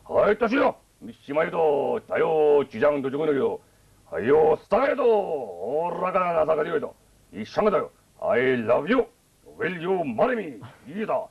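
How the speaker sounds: high mock Japanese gibberish, slowing into a proposal; mock Japanese accent. Imitating Japanese guys to the host, high mock Japanese accent. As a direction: rattle off fake Japanese names in a high voice. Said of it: "Japanese guys who keep going".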